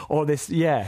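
ordinary delivery

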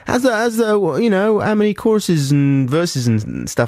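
high-pitched, tentative phone check-in; mock phone call. Acted phone call between the two writers.

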